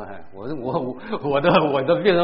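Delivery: ordinary talk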